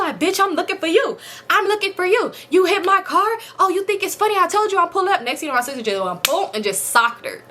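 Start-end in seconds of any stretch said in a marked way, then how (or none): 0.2-5.2 higher, hammed-up aggressive older woman; mock confrontational lady. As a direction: play the lady squaring up, mock fury. Angry lady confronts her.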